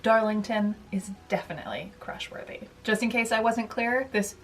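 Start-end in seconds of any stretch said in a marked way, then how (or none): 0.9-2.6 soft conspiratorial whisper; whispered aside. Acted. Vlogger confiding her fictional crush, whispering. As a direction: let the viewers in on a secret.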